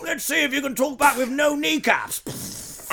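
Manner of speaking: high, slow, loud threat; mock explorer villain. Musket man about to shoot a pupil, hammed-up menace. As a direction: draw out the threat with theatrical relish. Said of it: "the old school explorer guy with a musket".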